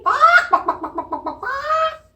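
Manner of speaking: very high laughing bawks; giggly high hen clucks; chicken clucking. Shown as a demonstration. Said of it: "let's be a chicken".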